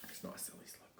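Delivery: put-on whispered aside to the kids; soft hushed whisper. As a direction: breathe it like a secret.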